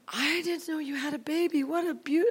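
put-on high-pitched cooing over a baby; retold delighted surprise; delighted gushing.

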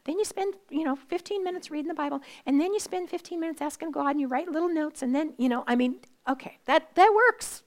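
lightly hammed-up bossy advice voice; mock rule-giver. Preacher mocks someone handing her a Bible plan, brisk and bossy. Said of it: "Somebody says".

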